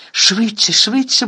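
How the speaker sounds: begging a friend to run, loud and urgent; pleading urgently. A narrator's reading. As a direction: beg him to hurry, pushing hard. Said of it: "благала Герміона".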